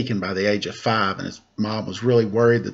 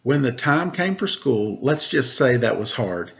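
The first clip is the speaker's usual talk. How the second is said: put-on bright, higher read-aloud voice for children; storybook narration; 6 semitones higher than usual. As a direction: read it like a picture book to kids. Reading a picture book aloud.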